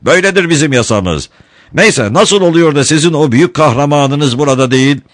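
high-pitched defiant declaration; shouting. A narrator's reading. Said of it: "diye bağırdı Ringo".

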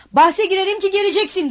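high, loud call after someone; shouting after him. A narrator's reading. Razumihin calling after departing friend, loud shout. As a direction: call it out to his back. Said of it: "diye bağırdı Razumihin".

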